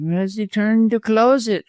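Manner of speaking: high, pointed comeback; smart retort. A narrator's reading. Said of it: "retorted Busham smartly".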